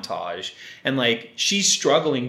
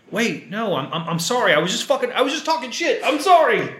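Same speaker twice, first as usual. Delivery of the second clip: panicked apology; 7 semitones higher than usual. Acted. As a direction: backpedal desperately, ending on a high cry. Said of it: "he has this human moment".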